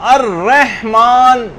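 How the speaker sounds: الرحمن stretched as ar-reh-maan with an added y-like e; mistaken drawn-out reading. Shown as a demonstration. Teacher warning against an added sound, exaggerated mispronunciation. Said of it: "یوں نہ کریں".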